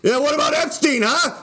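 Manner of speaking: hammed-up drunk cheerleader voice; drunk cheering. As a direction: whoop him on like a tipsy heckler at the back. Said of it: "sneaking hits off a flask and be like".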